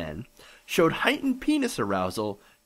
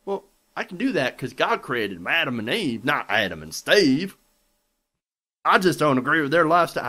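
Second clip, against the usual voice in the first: mocking Tennessee accent, building louder at the end; 4 dB louder than usual. Host mimics a store owner refusing service, mock Tennessee accent. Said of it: "I guess the accent fits".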